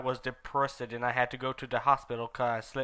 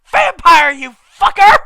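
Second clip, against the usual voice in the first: much higher, much louder furious cry; angry yell; 18 dB louder, slower than usual. Acted. Ebony yelling at Vampire while slapping him. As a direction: scream it as you slap him.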